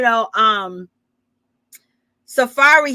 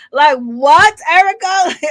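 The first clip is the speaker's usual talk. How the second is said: high, loud acted "what?!"; mock exasperation; 5 semitones higher, 6 dB louder, slower than usual. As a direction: fire it back loudly, pitched up, mimicking a snub. Acted snappy retort to Erica.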